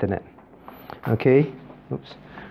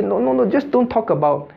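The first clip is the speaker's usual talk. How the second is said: higher-pitched dismissive protest; mock objection; 7 semitones higher than usual. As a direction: wave it away in a raised pitch. Acting out objectors who forbid such talk.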